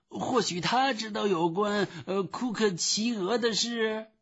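high-pitched, stammering bumbler; high-voiced, fussy official; flustered phone clerk. A narrator's reading. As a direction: placate hastily in a high put-on voice.

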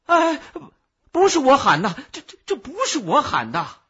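慌张地高声辩解; 又高又响，结巴着喊冤; 急忙大叫. A narrator's reading. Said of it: "我急忙大叫". (急得大喊，连忙撇清)

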